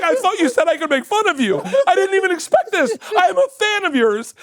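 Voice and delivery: very high, loud retold pleading; freaked out. Comic pleading with stars who came to fight him, freaked out. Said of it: "I was so freaked out".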